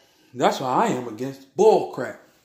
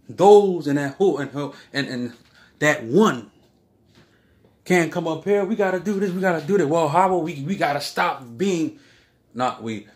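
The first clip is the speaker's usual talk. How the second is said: ordinary talk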